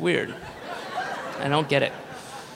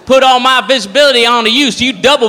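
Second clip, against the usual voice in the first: hammed-up, higher-voiced bar-room boasting; 4 semitones higher, 4 dB louder than usual. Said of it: "they're fucking drunk and dumb".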